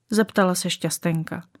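ordinary delivery, nothing marked

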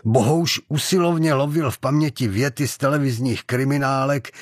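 ordinary delivery, nothing marked

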